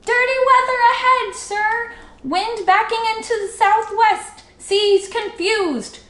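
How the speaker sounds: high, loud sailor's report shouted to shore; calling out. A narrator's reading. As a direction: sing out the warning across the water. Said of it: "he waved his hat and called out".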